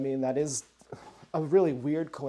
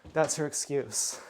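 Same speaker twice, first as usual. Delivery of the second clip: usual speaking voice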